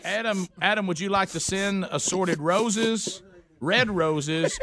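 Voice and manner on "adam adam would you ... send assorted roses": ordinary talk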